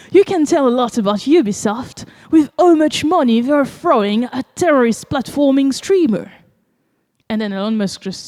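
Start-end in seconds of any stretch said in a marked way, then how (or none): 0.1-6.3 louder, higher quoting voice; declaimed tweet reading. Acted. Streamer retrying the American's tweet, declaimed loudly. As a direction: project it loudly as somebody else's outraged post. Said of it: "I cannot do an American accent".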